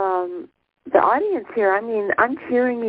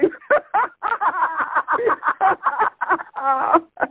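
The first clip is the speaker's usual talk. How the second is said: a long delighted laugh, pitched up; high giggling laugh; 5 semitones higher than usual. Real, not acted. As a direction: laugh helplessly at the joke. Laughing at album title.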